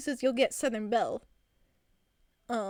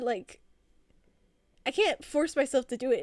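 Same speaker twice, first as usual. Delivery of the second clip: ordinary talk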